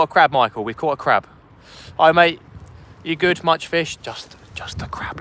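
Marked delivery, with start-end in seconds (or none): 4.0-5.2 sulky whisper. Pretend. Whining at asking viewers.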